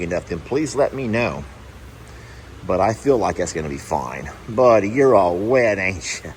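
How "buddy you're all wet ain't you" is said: usual speaking voice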